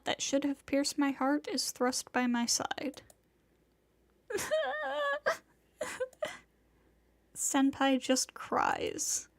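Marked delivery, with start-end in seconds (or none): 4.3-5.4 put-on high broken sob from the girl; crying sob. Girl sobbing before the narrator. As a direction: let a high wail break out.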